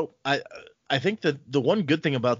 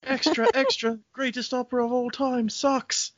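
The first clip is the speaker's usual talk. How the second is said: high, far newsboy cry; high mock newspaper crier; newsboy call; 13 semitones higher than usual. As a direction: hawk the headline on a street corner.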